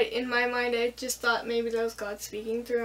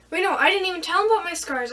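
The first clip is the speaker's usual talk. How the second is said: sudden correction; 5 semitones higher, 6 dB louder, faster than usual. Real, not acted. Catches her own memory slip.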